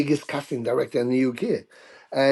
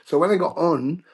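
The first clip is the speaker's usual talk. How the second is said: ordinary delivery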